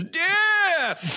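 put-on high-pitched old man cry; Abraham Simpson. Imitating a cartoon grandfather's cry, high and hammed-up. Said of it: "Like the way, uh, Abraham Simpson says".

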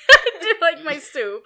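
giggly, amused reply; laughing. Real, not acted. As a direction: answer through real giggles. Laughing at listener's teasing question.

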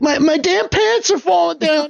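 high-pitched comic panic; hammed-up character in distress; flustered character. GM voices flustered fleeing character.

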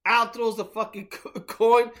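ordinary talk

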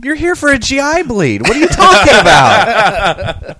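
high, laughing disbelief; laughing incredulous retort. Retold or imitated. Nurse retells answering a patient, high laughing disbelief. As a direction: scoff at it through laughter.

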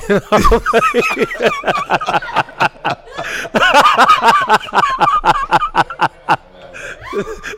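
long, high, loud laughing fit that won't stop; helpless laughter. Real, not acted. Telling the host about the note, bursting into laughter. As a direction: crack up completely at the memory. Recalls getting a note on stage.

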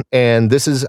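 normal talking voice

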